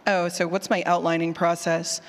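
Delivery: normal talking voice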